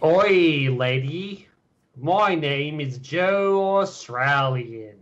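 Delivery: a drawn-out mock Aussie greeting; joking Australian impression; Australian accent. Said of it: "That's my Australian accent".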